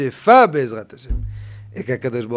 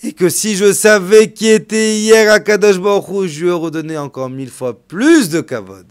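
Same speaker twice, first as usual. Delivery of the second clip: high, loud, impassioned sermon climb; preacher rising to a loud impassioned climax; fiery preaching; 4 semitones higher, 12 dB louder than usual. Acted. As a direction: build the sermon to a loud peak. Preaching at full force.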